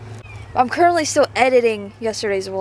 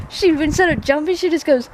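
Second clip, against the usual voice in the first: ordinary talk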